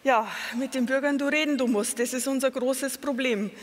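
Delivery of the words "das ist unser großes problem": usual speaking voice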